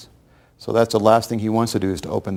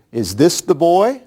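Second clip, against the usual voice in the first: loud demand; 8 semitones higher, 6 dB louder, slower than usual. Retold or imitated. Kidnappers asking at the car window.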